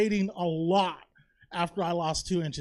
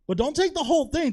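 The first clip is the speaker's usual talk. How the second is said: higher, louder sudden warning; urgent doctor warning; 7 semitones higher, 6 dB louder than usual. Retold or imitated. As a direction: stop him before he overdoes it. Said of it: "was like".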